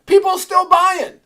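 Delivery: loud and high quoting of a buddy; friend Garrett. Retold or imitated. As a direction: shrug it off, fired up. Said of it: "He's like".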